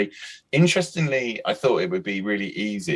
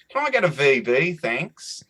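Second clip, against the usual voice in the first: lightly put-on, higher Australian accent; mock Australian accent; 7 semitones higher, slower than usual. Aussie drinker orders at a London bar, mock Australian accent. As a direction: play an Aussie punter, pitched up, in Australian accent.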